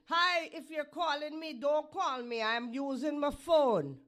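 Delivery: high, loud mock answering machine; loud joking phone greeting. Real, not acted. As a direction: bark it at the caller.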